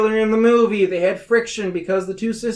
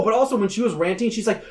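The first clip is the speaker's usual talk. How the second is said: ordinary talk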